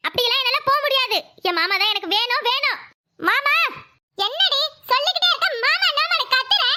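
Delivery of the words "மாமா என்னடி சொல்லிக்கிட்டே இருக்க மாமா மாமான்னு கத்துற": angry scolding. Acted. Mother-in-law scolding daughter-in-law.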